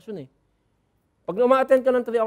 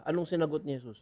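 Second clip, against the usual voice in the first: usual speaking voice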